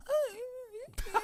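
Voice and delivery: very high, squeaky girl imitation; high-pitched voice. Host imitates a girl judging his body, high-pitched voice. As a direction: squeak out a hesitant mock agreement. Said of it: "You're using a high pitched voice".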